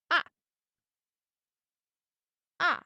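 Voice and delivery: short "Ah", dropping fast; falling tone. Shown as a demonstration. Teacher drilling the fourth tone, quick falling "Ah". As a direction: fourth tone "Ah", a quick firm fall. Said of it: "go down quickly and strongly".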